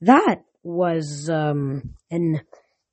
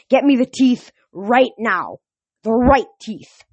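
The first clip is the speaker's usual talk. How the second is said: slow, loud, higher-pitched order; exasperated command; 5 semitones higher, 5 dB louder than usual. A narrator's reading. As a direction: spell it out forcefully for a fool. General orders the guard.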